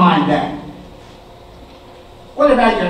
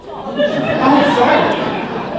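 laughing; 9 semitones higher, much slower than usual. Acted. Refusing to give blood.

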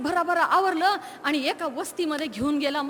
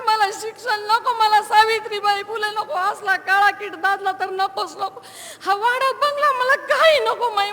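tearful pleading; 3 semitones higher than usual. Acted. Girl begs her mother not to marry her off, crying. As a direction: beg mother through tears. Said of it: "इतक्या मोठ्याने रडले ग माई".

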